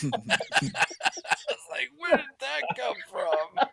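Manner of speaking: high, slow words through laughter; laughing disbelief. Retold or imitated. Retelling his reaction to the friend's joke, laughing through it. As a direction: laugh while asking it. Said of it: "start laughing".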